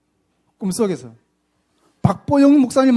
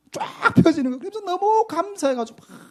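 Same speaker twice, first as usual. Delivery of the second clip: 1 elated; 9 semitones higher than usual. Real, not acted. Ecstatic recalling of healing.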